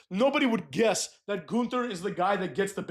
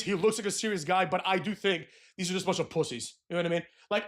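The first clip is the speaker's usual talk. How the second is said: ordinary talk, nothing marked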